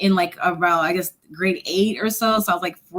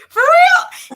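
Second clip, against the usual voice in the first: much higher, louder, near-squeal; teen squealing at the officer's praise; stunned disbelief; 7 dB louder than usual. Retold or imitated. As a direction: squeal it as if you can't believe it. Stunned by the compliment.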